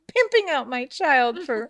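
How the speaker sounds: high, laughing through the words. Real, not acted. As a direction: laugh in disbelief as you say it. Laughs at her own story.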